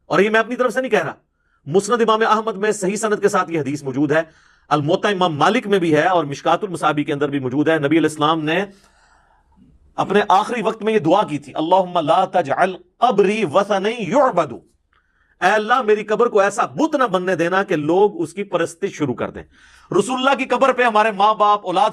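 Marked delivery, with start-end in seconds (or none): none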